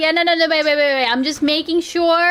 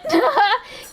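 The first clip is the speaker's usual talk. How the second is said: sudden squealing laugh, much higher; high burst of laughter; 11 semitones higher than usual. Real, not acted. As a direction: let a high giggle burst out. Laughs after mock rant.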